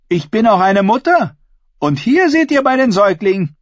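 high, projected declaration; ringing, high-pitched boast; bold woman addressing assembly. A narrator's reading. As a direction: show off to the whole gathering.

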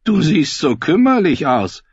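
high, loud bleating sheep voice. Acted. Davin voiced by narrator.

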